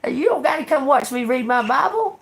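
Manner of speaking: high, laughing dismissal; mocking laugh while telling her off; laughing scorn. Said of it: "I said".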